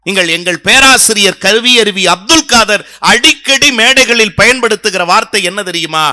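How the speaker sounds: ordinary delivery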